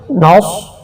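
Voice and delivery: slow chanted tajweed recitation; Quran recitation. Shown as a demonstration. Teacher demonstrating recitation to students, slow and chanted. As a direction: draw out each syllable in melodic recitation. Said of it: "Urdu تلفظ دیکھیے".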